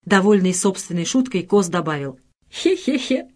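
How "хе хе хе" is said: high, eerie villain's heh-heh-heh; sinister Fantomas chuckle. A narrator's reading. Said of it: "Ну, точно Фантомас".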